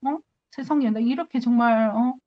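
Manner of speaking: ordinary talk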